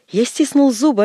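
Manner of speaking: plain reading voice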